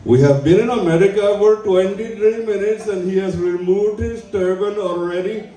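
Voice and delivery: lightly put-on Indian accent, scolding. Airport travelers condemning the comic, in a lightly mock Indian accent. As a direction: play the disapproving traveler, a hint of Indian accent. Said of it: "they're staring at me".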